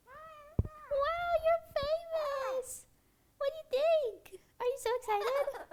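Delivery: high squeaky pet voice, slow and sing-song. Real, not acted. Baby talk to her parrot.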